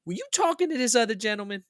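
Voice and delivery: high imitation of a Southern woman; Southern lady. Airline lady questioning the buddy, high Southern-lady voice. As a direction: pitch it up as the Southern agent asking. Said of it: "she was very Southern".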